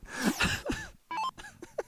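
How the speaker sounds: high-pitched burst of laughter; high giggle. Real, not acted. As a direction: laugh up in falsetto. Laughing after silly demo.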